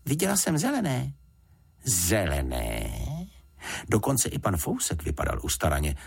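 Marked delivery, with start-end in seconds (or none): 0.1-1.1 put-on high-pitched girl's report; young girl, pitched well up; Juditka. A narrator's reading. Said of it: "řekla Juditka". 1.8-2.9 low, slow, incredulous echo; drawn-out low questioning; taken aback. A narrator's reading. Adult reacts to girl's claim.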